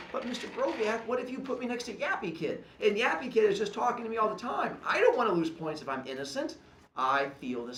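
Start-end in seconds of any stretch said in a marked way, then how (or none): 0.1-6.6 worried student. Retold or imitated. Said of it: "you're worried and you go".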